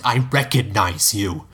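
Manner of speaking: slow, pleading, close to tears; tearful plea. A narrator's reading. Delphi pleading with Cedric, slow and tearful. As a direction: beg him through welling tears. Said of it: "tears welled in her eyes".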